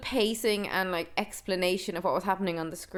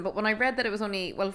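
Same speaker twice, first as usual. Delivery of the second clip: ordinary delivery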